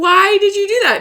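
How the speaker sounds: high, loud incredulous wail; mock exasperation. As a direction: demand an explanation in hammed-up dismay. Acted alarmed challenge in retelling.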